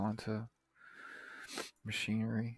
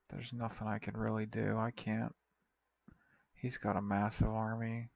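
usual speaking voice

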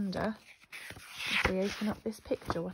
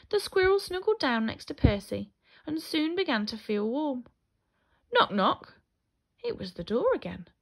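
lively picture-book storytelling; reading to children; 4 semitones higher, 8 dB louder than usual. A narrator's reading. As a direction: tell the story to small children, animated.

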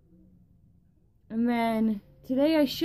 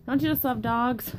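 ordinary talk, nothing marked

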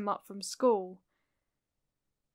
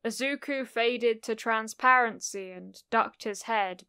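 usual speaking voice

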